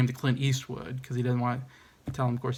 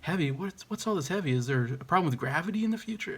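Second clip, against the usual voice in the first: higher, baffled scientist voice; puzzled Doc; 5 semitones higher, 3 dB quieter than usual. Retold or imitated. 1955 Doc puzzles over Marty's slang, baffled. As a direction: question the strange word in disbelief. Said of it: "the Doc of nineteen fifty-five was like".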